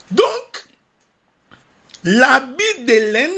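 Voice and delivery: high, emphatic preaching with slow stressed words. Acted. Preacher explaining the wool garment to the church, emphatic preaching. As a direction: drag out the key words like a sermon climax.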